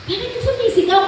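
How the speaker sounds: startled high question, half laughing; startled awake, asking why he shouts. Retold or imitated. Wife woken at dawn, startled high-pitched question. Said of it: "Di nagulat ako".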